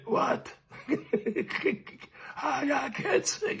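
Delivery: soft, high, strangled squeak; lost voice. Retold or imitated. Boy who yelled himself voiceless at punch ball, squeaky lost voice. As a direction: squeak it out with no voice left. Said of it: "no voice, gone".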